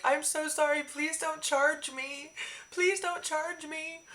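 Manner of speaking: very high, slow mock plea; pleading Michael. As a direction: beg sweetly like a guilty kid. Michael apologizing after breaking mic.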